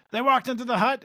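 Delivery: ordinary talk, nothing marked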